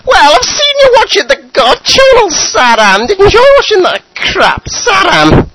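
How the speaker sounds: put-on high, loud, wailing old mother voice; nagging mother. Mother scolding Saddam at midnight, high wailing nag. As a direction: screech it out as his fed-up mum.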